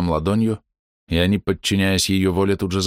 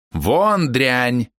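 angry shout; 5 semitones higher than usual. Acted. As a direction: drive her out with fury. Old woman drives girl away.

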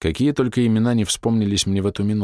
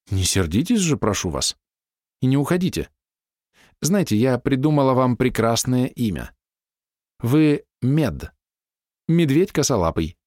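lightly raised coaxing woman's voice; young woman voiced; 5 semitones higher than usual. A narrator's reading. Narrator voicing the woman soothing the grumpy writer, lightly higher. Woman naming him Bear.

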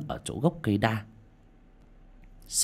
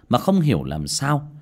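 ordinary delivery, nothing marked

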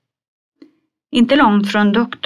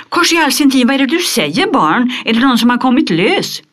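high, loud, hurried cry of alarm; frightened shriek; 8 semitones higher, 5 dB louder, faster than usual. Acted. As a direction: shriek like a startled old lady. Skrek till av förskräckelse. (förskrämt skrik, högt och snabbt)

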